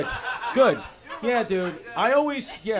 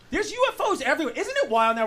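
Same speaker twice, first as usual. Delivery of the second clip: excited; 9 semitones higher, faster than usual. Real, not acted. Excited high rush.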